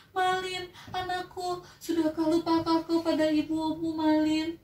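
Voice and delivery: high, grieving plea; pleading mother. Acted. Mother asking her son if he forgot her, pleading. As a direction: ask him if he forgot you. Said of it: "katanya gitu".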